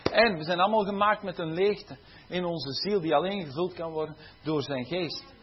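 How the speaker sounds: normal talking voice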